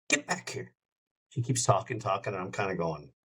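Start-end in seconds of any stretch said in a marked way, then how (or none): 0.1-0.7 whispered, much softer "Get back here"; hushed call. Retold or imitated. Singer on a radio call hissing at her runaway dog, hushed. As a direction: hiss it under the breath. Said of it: "She says".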